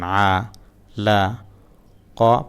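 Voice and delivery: syllables split apart with pauses, stalling on the next; broken syllable reading. Shown as a demonstration. Teacher acting out a learner stuck mid-word, slow and separated. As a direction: read piece by piece and get stuck. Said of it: "এইভাবে পড়তে গিয়ে ... আটকায় গেলেন".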